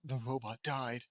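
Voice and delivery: plain reading voice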